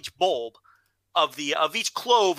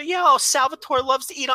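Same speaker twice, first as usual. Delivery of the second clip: doting mother; 9 semitones higher, 5 dB louder than usual. Retold or imitated. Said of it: "and the mother".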